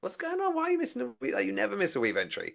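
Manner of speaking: baffled, rubbing her head at the dog; confused. Retold or imitated. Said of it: "rubbed my head and was like".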